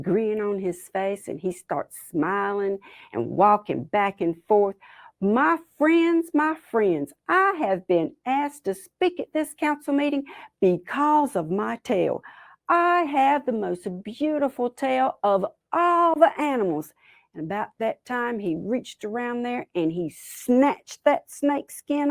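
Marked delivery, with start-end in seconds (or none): 5.2-16.9 high, grand public speech; pompous Possum. Acted. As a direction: orate proudly to the whole council. Possum addresses the council pompously.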